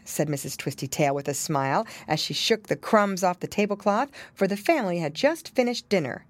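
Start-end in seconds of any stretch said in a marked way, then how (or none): none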